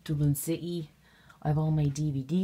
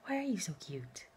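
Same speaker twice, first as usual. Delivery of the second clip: whispered coo; 3 semitones higher, 8 dB quieter than usual. Real, not acted. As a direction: whisper it lovingly to the dog. Cooing over her dog.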